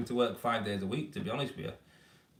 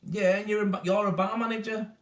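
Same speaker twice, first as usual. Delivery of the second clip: high, loud and delighted to place someone; excited recognition; 11 semitones higher, 6 dB louder than usual. Real, not acted. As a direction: call out as the memory clicks. Recognises an old pupil in chat.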